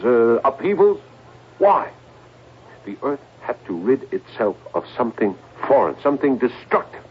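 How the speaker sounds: ordinary delivery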